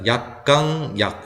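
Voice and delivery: slow "약강, 약" with 강 lifted high; weak-strong-weak stress. Shown as a demonstration. Teacher repeating the stress pattern slowly, strong middle beat. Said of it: "이렇게 됩니다".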